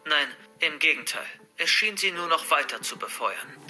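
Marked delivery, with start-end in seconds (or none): none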